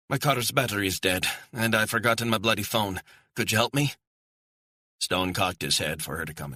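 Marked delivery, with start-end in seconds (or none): 0.1-3.9 put-on higher-pitched woman with a light Scottish accent; educated Scottish accent. A narrator's reading. Stranded woman asks a stranger for help, educated Scottish accent. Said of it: "Her accent was educated, Scottish".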